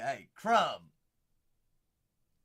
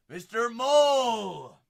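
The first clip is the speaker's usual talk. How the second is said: slow, loud, higher "Mister Maul!"; hyped callout; 6 semitones higher, 12 dB louder, much slower than usual. Acted. Calling out a pulled card to Irish, slow and loud. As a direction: stretch it out like a ring announcer.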